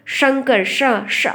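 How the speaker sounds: शंकर with a clear "sh", then श alone; whistling sh. Shown as a demonstration. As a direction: isolate the hushing "sh" of शंकर. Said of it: "सीटी निकलनी चाहिए ना कुछ".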